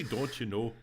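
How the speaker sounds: a mild Minnesota "Don't you know?"; lightly mock a Minnesotan; Minnesota accent. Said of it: "they don't say".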